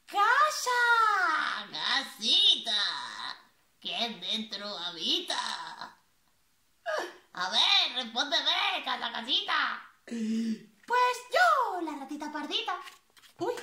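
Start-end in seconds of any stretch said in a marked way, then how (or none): 0.1-9.8 slow sing-song call at the door. Acted. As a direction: call out to the little house. Magpie calls at the door.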